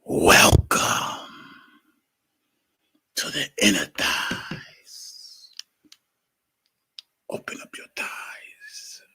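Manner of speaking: slow, whispered come-on; hushed intro. Acted. Blogger teasing listeners during a mic check, whispering.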